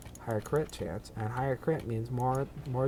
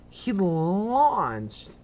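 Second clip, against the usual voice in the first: high, loud amazement; amazed; 7 semitones higher, 7 dB louder than usual. Real, not acted. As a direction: marvel at the launch. Amazed at launched worm.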